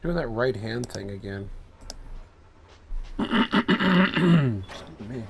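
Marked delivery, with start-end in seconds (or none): 3.2-5.2 throaty, after a cough; clearing throat. Real, not acted. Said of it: "clears throat".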